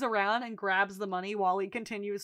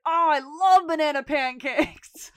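loud, slow, higher-pitched gushing; imitated delight; 5 semitones higher, 8 dB louder than usual. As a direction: draw it out with hammed-up relish. Acting Mickey's delight at breakfast.

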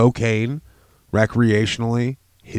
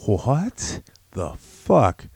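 slow, stretched disbelief; disbelieving what the fuck; 2 semitones higher, much slower than usual. Retold or imitated. Recalling watching the documentary, slow stunned disbelief. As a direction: drag it out in shocked disbelief. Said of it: "And I'm like".

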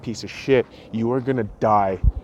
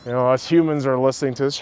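normal talking voice

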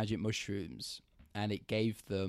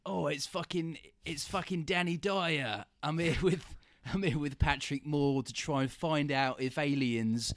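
higher, louder mock Cockney TV presenter; bad Cockney impression, higher and louder; Danny Dyer impression; 5 semitones higher, 6 dB louder than usual. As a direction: ham up a Cockney telly host, laughing through it. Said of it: "Do an impression of his voice".

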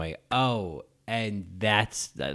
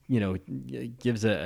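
ordinary delivery

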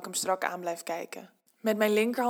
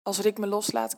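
ordinary delivery, nothing marked

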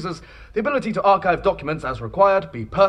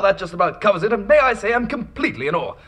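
ordinary delivery

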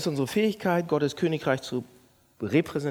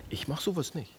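much softer, self-satisfied; smug aside; 9 dB quieter than usual. Shown as a demonstration. Pastor voicing the smug inner voice. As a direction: murmur it smugly to yourself. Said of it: "Merkt ihr das?".

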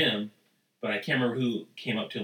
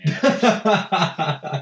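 laughing; 7 semitones higher, 9 dB louder than usual. Real, not acted. Laughing recalling denim.

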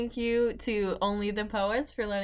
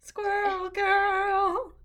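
high, slow announcer call; dramatic announcer; 9 semitones higher, much slower than usual. Pretend. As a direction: draw out the name like a show intro. Echoed hero name call.

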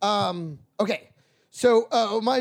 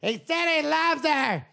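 high, slow mock parent explaining the plot; mimicking parents at the movies; 8 semitones higher, slower than usual. Comic explaining the plot to his father in a high mock voice. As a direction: lean over and repeat the line, drawn out.

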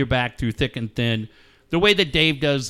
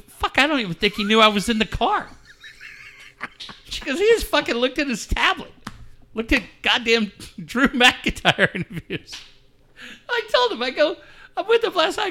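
high, squeaky voice cracking up with laughter; laughing through the story; 10 semitones higher than usual. Real, not acted. Dad retelling his ex-wife's story to his co-host, cracking up.

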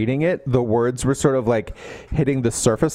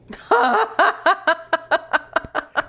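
laugh. Real, not acted. Laughing at the premise.

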